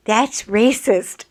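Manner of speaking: loud, slow accusing line; indignant passerby. Retold or imitated. Woman walking by, loudly indignant. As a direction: call it out like an offended stranger. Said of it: "she goes".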